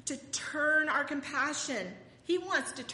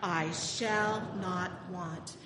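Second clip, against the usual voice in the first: slow, louder recitation for the congregation to echo; 3 semitones lower, 4 dB louder, slower than usual. Shown as a demonstration. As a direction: lead the people in the psalm, slowly. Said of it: "Say it with me".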